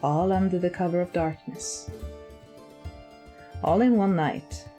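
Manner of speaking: plain reading voice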